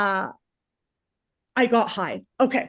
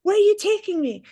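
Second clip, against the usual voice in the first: high-pitched mock yelling at a driver; acted frantic yell, pitch way up; retold panicked yell; 12 semitones higher than usual. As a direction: imitate yourself shrieking questions at the driver. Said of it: "I'm yelling at the driver".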